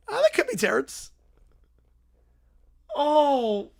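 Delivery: ordinary talk, nothing marked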